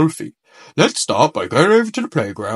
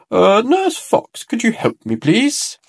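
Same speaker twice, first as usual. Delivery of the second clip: sheepish plea for help; 7 semitones higher than usual. A narrator's reading. Bear stuck, asks for help.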